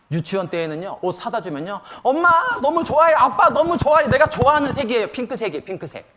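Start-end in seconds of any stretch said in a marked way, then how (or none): 2.0-6.1 high, excited mock kindergartner squeal of joy; delighted little child. Kindergarten daughter thanks parents for pink clothes, overjoyed imitation. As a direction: imitate a child thrilled to bits, easing off at the end. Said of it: "핑크색 사다 주면 펄쩍펄쩍".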